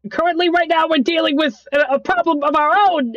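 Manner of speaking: a frazzled voice crackling through a call; high pitched voice. Acted. NPC voice over a call.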